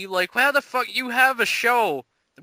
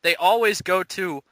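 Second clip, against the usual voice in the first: usual speaking voice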